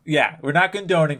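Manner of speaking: normal talking voice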